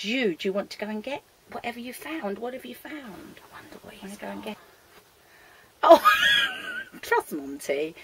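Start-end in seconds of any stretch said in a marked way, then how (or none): 6.1-7.0 high squealing laugh; sudden high-pitched crack-up; helpless laughter. Real, not acted. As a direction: burst out in shrieking giggles. Pet brings toilet roll.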